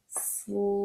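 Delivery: soft stretched "Slam."; blended slowly. Shown as a demonstration. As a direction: draw "Slam." out smoothly. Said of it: "Did you guys hear how I did that?".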